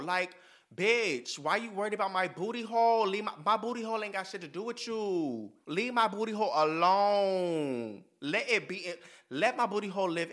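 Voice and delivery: indignant rant. Acted. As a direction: tell them to back off.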